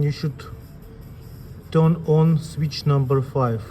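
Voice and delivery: ordinary talk, nothing marked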